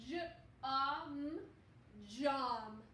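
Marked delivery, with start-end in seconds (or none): 0.1-1.4 J-O-M, each letter named separately; spelling out letters. Shown as a demonstration. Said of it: "So let's read the word".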